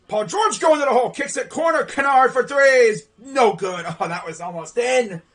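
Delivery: loud, excited game call; live play-by-play. Real, not acted. Commentator watches a three miss, exasperated play-by-play. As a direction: call the shot, then groan at the miss.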